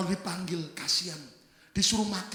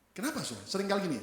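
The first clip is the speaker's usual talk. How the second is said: ordinary talk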